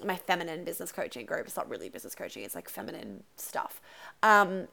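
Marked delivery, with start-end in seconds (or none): 1.5-3.8 voiceless whispered aside. Real, not acted. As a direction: drop to a secret whisper. Whispered aside about her group.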